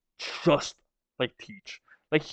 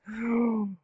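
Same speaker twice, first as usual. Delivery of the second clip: soft gasp; 7 semitones higher, 5 dB quieter than usual. Real, not acted. Reacting to Sebek reveal.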